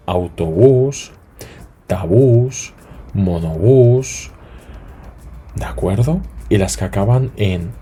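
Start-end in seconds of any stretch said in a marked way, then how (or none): 0.1-1.1 "autobús" said slowly, stress on "bús"; slow stress on last syllable. Shown as a demonstration. Teacher models "autobús" for students, slow and stressed. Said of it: "Las que acaban en vocal más S". 3.1-4.3 "monobús" said slowly, stress on "bús"; slow stress on last syllable. Shown as a demonstration. Teacher models "monobús" for the class, slow and stressed. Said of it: "Las que acaban en vocal más S".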